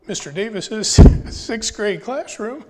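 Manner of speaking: fighting tears with a nervous laugh; choked up. Real, not acted. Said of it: "I don't know why I'm getting emotional".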